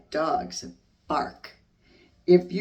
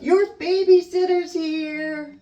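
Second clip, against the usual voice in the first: playful shout; 6 semitones higher, 5 dB louder than usual. Acted. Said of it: "you can shout".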